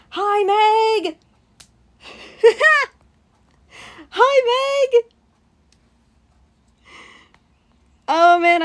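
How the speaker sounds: high, loud excited greeting. Real, not acted. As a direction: squeal hello to a friend. Greeting a friend joining the stream.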